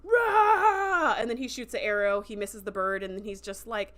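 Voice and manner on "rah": loud, high drawn-out roar; mock enraged roar. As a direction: roar like a frustrated warrior dad. Dad roars at son's refusal.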